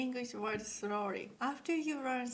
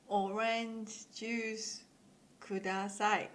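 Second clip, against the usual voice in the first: slow demo; slower than usual. Shown as a demonstration. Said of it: "So please say it slowly".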